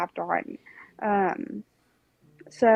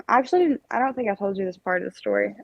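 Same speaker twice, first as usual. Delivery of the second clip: ordinary talk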